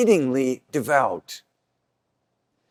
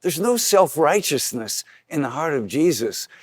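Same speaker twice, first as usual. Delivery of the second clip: usual speaking voice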